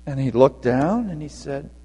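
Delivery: ordinary delivery, nothing marked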